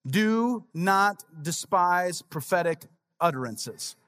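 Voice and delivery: every word drawn out slowly; slow solemn reading. Shown as a demonstration. Pastor slowly reading the next verse to the congregation. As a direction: read it slow and weighty, like scripture. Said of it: "What's the next verse?".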